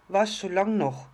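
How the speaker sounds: put-on high, loud impatient child outburst. A narrator's reading. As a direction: blurt it out, can't wait any longer. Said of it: "sagte das kleine Sterndl ungeduldig". (ungeduldig, hoch und laut wie ein Kind)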